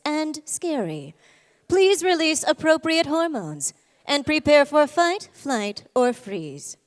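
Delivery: slow, loud, formal PA voice; intercom announcement. Acted. The hypothalamus paging the endocrine system over an intercom. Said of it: "gets on the intercom and it goes".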